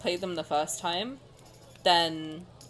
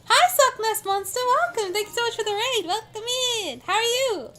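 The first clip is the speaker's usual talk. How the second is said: high, loud, bubbly greeting; excited welcome; 12 semitones higher, 4 dB louder than usual. Real, not acted. Streamer greeting raiders with thanks, excited high welcome. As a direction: pitch way up, gush the thanks.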